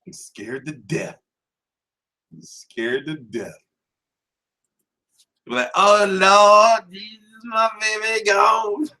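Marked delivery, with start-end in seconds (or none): 0.1-3.6 hushed mock fear. 5.7-8.9 hammed-up grieving mother at a funeral; wailing church mother. Fan mourning Khan like a church mother, mock wail.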